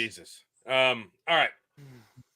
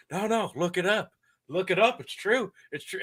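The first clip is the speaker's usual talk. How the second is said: insistent mimicry; 7 semitones higher than usual. Mimicking insistent speech-giver on claims.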